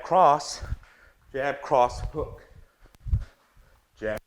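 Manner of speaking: rhythmic punchy combo calls; boxing coach calling combos. Acted. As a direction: bark out each punch in time.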